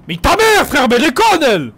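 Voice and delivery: high, loud mock anger; hammed-up indignant shout; exasperated outburst. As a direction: blurt it out in disbelief. Outburst at duel suggestion.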